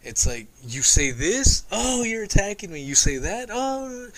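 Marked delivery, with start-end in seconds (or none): 1.7-2.8 high-pitched mocking whine of protest; exaggerated, high wounded protest; mock victim. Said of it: "she paints herself as a victim". 3.5-4.1 very high mocking "oh"; exaggerated high-pitched woe; mock victim. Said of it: "she paints herself as a victim".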